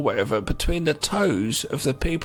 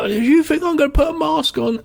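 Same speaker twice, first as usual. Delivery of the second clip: much higher, louder mock outrage; indignant high mimicry; 11 semitones higher, 4 dB louder than usual. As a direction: squawk it like the vain crook. Quoting the permed crook.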